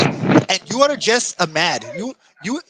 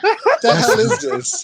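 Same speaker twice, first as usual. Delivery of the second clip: high, slow laughing scoff; laughing disbelief; slower than usual. Real, not acted. Friend mocking a streamer's brag, laughing scoff.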